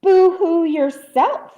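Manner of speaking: plain reading voice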